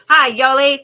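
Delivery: loud, high greeting; big excited-sounding hail; calling out. Real, not acted. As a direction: holler hello to someone joining. Hailing viewer whose turn came.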